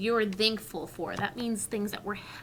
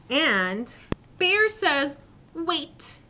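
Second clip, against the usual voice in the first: storybook narration; 5 semitones higher, 6 dB louder, much slower than usual. Acted. Reading the story aloud.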